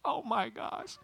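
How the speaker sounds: high, tiny, very soft gasp of dismay; acted-out panic under the breath; hushed dread. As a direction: squeak it quietly in horror. Sees dad through the kitchen window.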